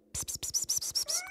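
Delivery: unvoiced psst psst coaxing; psst to a kitten. A narrator's reading. Ella coaxing the kitten under the workbench, soft psst. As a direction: lure the kitten with soft hisses.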